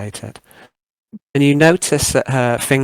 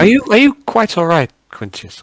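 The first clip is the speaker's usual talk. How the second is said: high, loud fussing concern; startled, solicitous host; concerned interruption; 8 semitones higher, 7 dB louder, faster than usual. Acted. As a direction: cut in fast, offering help. Host interrupts flustered guest, concerned.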